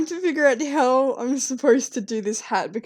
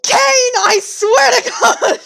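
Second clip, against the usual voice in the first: much higher, louder, slow yell; mock rage shout; 13 semitones higher, 10 dB louder than usual. Real, not acted. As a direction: scream at your friend in playful fury.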